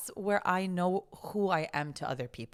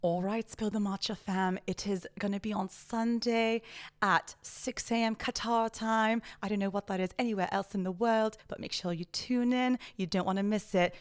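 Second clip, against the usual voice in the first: put-on British show plug; playful mock-posh British promo; British accent. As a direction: announce it in a proper upper-class British accent. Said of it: "in your British accent".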